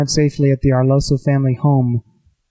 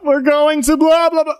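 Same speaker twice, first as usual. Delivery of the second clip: mock scream; 11 semitones higher than usual. Game master mimics the players announcing their destination, mock scream. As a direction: call it out high and stretched, imitating a scream. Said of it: "you'd all have screamed out".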